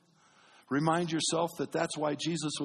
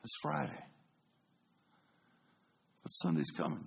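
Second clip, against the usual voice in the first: quiet refrain; 7 semitones lower, 6 dB quieter than usual. Acted. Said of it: "echoing the refrain softly".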